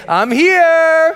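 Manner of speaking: loud, high drawn-out shout; rallying shout. Real, not acted. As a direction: shout it out to fire up the crowd. Rallying crowd to shout along.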